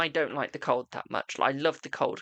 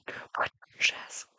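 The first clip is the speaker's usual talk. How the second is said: slow playful whisper; 3 dB quieter, slower than usual. Pretend. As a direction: breathe it out slowly. Repeating plea as a whisper.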